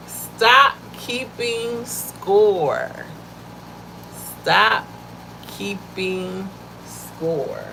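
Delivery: slow, every word of "Stop keeping score" stretched out; drawn-out emphasis. Shown as a demonstration. Speaker drilling the lesson into her listeners, slow emphatic command. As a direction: lean hard on "Stop", then drag the rest. Preaching the key point.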